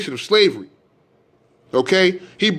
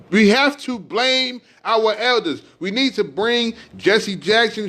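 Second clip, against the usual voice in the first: raised, pitch-lifted sermon rant, stretching key words; fiery preaching; 7 semitones higher than usual. Acted. Preacher railing at listeners about their elders, fiery sermon. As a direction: preach it hard at the congregation, voice climbing.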